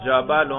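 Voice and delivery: جبل said as "jabalun"; nominative -un ending. Shown as a demonstration. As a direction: end جبل clearly on "-un". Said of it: "رفع پر اس کے ہم تلفظ کر رہے ہیں". (جبل کو "جَبَلُن" پڑھیں)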